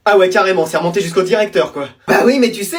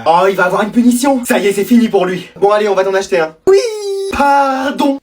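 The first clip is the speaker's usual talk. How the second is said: usual speaking voice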